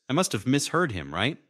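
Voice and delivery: usual speaking voice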